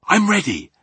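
high, loud, eager call; excited cry. A narrator's reading. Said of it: "Sophie cried".

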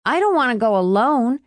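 begging. A narrator's reading. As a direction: plead like an anxious date. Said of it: "Lester begged".

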